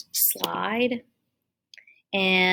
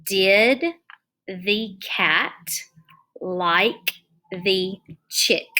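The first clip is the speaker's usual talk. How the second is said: slow read-aloud; much slower than usual. Shown as a demonstration. Teacher rereads sentence to count words.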